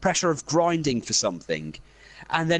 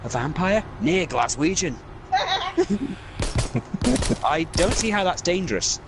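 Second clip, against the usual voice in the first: usual speaking voice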